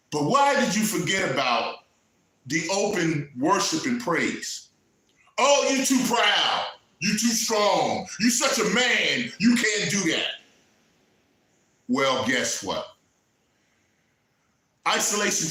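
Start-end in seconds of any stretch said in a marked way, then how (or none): 5.4-10.3 shouted mock taunt; mocking quote. Preacher mimicking scoffers who mock open worship, shouting. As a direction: throw their proud excuses back at them. Mocking what proud people say.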